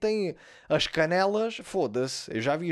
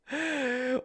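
riso agudo; 9 semitones higher than usual. Real, not acted. Riso alto.

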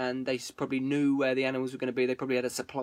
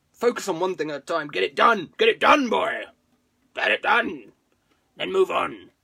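louder, punchy coach-style commands; self pep talk; 3 semitones higher, 6 dB louder than usual. Acted. Streamer hyping himself up, louder coach pep talk.

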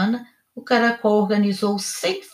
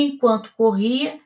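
plain reading voice

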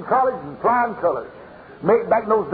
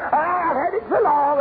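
higher, whiny mock of a tired member; mocking whine; 7 semitones higher than usual. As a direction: mimic the excuse with a sneer. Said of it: "Have you ever heard that stuff".

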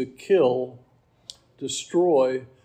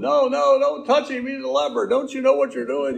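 alarmed protest; 10 semitones higher than usual. Pretend. Disciples protesting to Jesus in alarm, high and urgent. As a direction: cry out in alarm to stop him.

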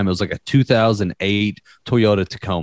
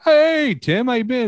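much higher, sing-song friendly cop; cheery cop greeting. Retold or imitated. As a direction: greet an old buddy brightly.

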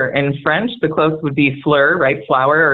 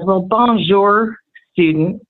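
Southern drawl teacher; 3 semitones higher than usual. Retold or imitated. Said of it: "he sits down and he says".

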